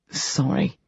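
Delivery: soft, mumbled apology; sheepish mutter. A narrator's reading. Rage apologising to the doctor, soft sheepish mutter. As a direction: mumble it like a scolded kid. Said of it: "he mutters".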